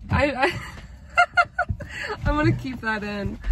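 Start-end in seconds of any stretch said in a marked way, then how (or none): 0.6-2.2 high laugh. Real, not acted. Bursts out laughing at herself.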